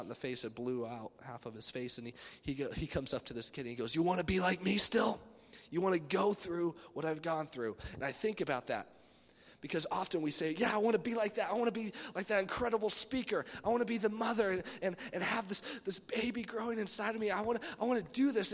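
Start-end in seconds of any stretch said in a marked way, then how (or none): 3.9-7.8 loud, higher put-on challenge; children's pastor impression. Scarred pastor confronting a kid who admires him, imitating. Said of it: "he talked like this". 10.6-18.5 high, enthusiastic gush; eager dreamer. Acted. People telling God what they dream of becoming, eager.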